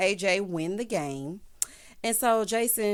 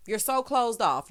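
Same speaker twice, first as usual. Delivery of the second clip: ordinary delivery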